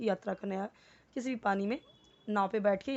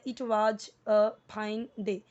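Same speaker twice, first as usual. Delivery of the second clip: it was a fine day, each word dragged out slowly; wrong slow reading; much slower than usual. Shown as a demonstration. Teacher showing the wrong, word-by-word reading. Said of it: "ऐसे आपको नहीं पढ़ना है".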